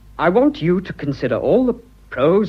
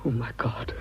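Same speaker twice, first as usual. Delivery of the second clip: softer, voiceless; whisper; 3 semitones lower, 9 dB quieter than usual. Acted. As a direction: breathe it out, overcome. Overwhelmed by her answer.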